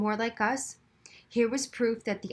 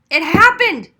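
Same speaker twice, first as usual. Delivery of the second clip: loud, high, hoarse cry; stunned boy bursting into the waiting room; rasping exclamation; 9 semitones higher, 15 dB louder than usual. A narrator's reading. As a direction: burst out breathless. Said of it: "he rasped".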